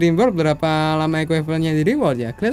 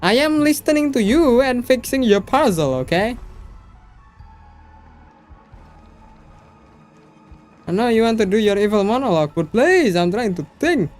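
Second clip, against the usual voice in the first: exasperated; 7 semitones higher than usual. Real, not acted. Streamer scolds game villain's monologue.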